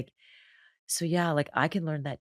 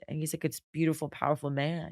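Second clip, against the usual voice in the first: ordinary delivery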